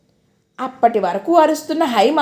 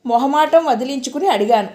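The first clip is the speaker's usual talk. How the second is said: ordinary delivery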